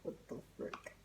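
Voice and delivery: whispered aside; a quick whispered what the frick. Real, not acted. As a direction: breathe it under your breath. Aside after teacher story.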